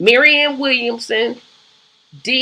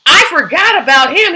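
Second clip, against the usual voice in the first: sudden realization; 6 semitones higher, 8 dB louder than usual. Real, not acted. Suddenly remembers a forgotten candidate.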